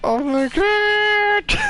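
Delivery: startled gamer shrieking at the screen; shocked shriek. Real, not acted. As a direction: shriek in real alarm. Game freaks out, screaming.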